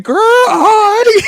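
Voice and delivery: delighted, laughing celebration; giddy cheer. Real, not acted. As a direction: cheer it out while cracking up. Laughing joy over the team's win.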